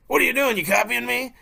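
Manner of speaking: high, loud mocking echo. Hunter copies an agitated friend's complaint, loud exaggerated mimicry. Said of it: "a little bit louder, a little bit more emphatic".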